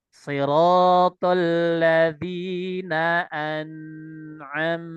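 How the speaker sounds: very slow, stretched Quranic recitation of "Siratal ladzina an'amta"; long drawn reading. Shown as a demonstration. Teacher demonstrating to students, stretching "Siratal ladzina an'amta" slowly. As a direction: draw out "an'amta" long, chanted. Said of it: "kita baca panjang sedikit sampai di sini".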